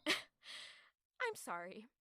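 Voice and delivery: slow, soft scornful apology; bitter sarcastic laugh. Acted. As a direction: laugh at him, then mock-apologise.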